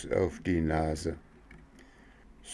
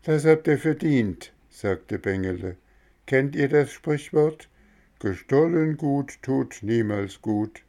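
put-on scolding boy; 6 semitones higher than usual. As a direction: lecture them with a proverb.

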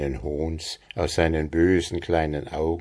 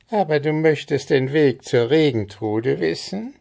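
higher, teasing goblin voice; sly goblin; 9 semitones higher than usual. A narrator's reading. Goblin tempts Andres with the way, high and sly. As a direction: dangle the secret slyly.

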